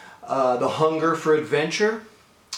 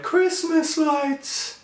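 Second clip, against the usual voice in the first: put-on dreamy kid's wonder; 12 semitones higher, much slower than usual. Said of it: "And I said to my dad".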